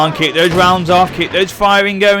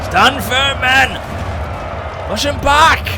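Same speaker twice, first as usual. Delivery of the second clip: raised, rallying the troops; booming rousing general speech; rousing battle command; 5 semitones higher, much slower than usual. Acted.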